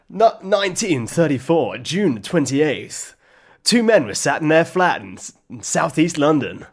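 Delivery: mock crime statement. Parodying true crime after being hit, dramatic narration. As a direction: deliver it in portentous, separated phrases. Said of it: "can I do a statement".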